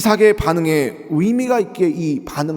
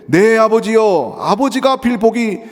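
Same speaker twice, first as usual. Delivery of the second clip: raised, higher pleading cry; loud pleading; 6 semitones higher, 5 dB louder than usual. Acted. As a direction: beg your father loudly. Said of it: "소리를 높여 울었다고".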